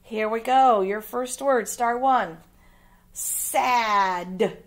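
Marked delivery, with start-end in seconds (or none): none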